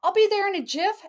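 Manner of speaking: high, loud, fast call; yelling. Acted. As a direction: yell it on the run. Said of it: "You yelled".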